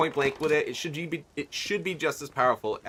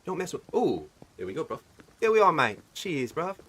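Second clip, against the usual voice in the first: put-on matey English accent, mostly raised pitch; 3 semitones higher than usual. Gamer bantering with mates in play, English accent. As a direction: keep up a playful English accent. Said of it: "switch to my, uh, English, English accent".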